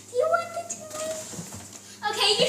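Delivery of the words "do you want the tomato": high sing-song coaxing, fast; coaxing a wild bird. Real, not acted. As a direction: sweet-talk the bird in a high voice.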